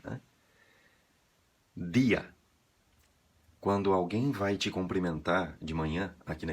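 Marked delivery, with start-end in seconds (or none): 1.7-2.3 "Dia" with a hard d, stress on i; Spanish dia. Shown as a demonstration. Teacher modelling Spanish "día" for learners. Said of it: "Ela é acentuada em espanhol".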